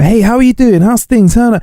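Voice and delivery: high, over-friendly greeting; mock cheery greeting. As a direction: act out greeting every stranger. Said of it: "if you were to say hi to everyone".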